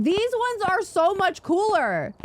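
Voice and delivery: high-pitched enthusiastic burst; gushing excitement. Real, not acted. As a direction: let the pitch soar with real enthusiasm. Raves about the new trophies.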